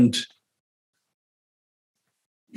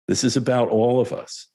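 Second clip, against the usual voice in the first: normal talking voice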